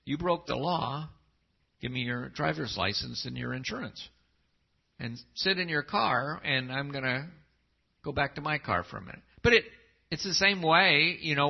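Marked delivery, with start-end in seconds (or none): 0.0-9.2 level, unhurried, no heat at all; just doing the job, calm and businesslike; even traffic-stop tone; matter-of-fact cop. Pretend. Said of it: "it's a very matter of fact".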